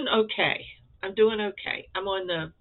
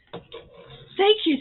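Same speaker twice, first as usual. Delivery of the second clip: gushing thanks; 12 semitones higher, 6 dB louder than usual. Real, not acted.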